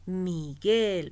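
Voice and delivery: loud, stretched GUEL in Miguel; stress on last syllable. Shown as a demonstration. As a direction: hit the end of Miguel hard. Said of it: "se pronuncia en la última sílaba". (acento fuerte en la GUEL de Miguel)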